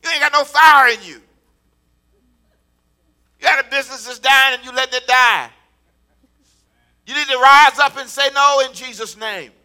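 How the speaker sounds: loud, rising sermon declarations; full-force preaching. Acted. Pastor rousing the congregation, loud preaching. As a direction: drive it home to the crowd.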